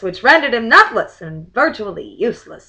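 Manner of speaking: lively verse recital; limerick reading. Acted. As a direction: recite it like a party rhyme. Reads limerick aloud.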